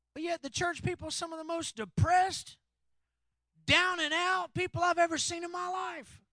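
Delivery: loud, driving sermon delivery; full-force preaching. Acted. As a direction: preach it hard to the congregation.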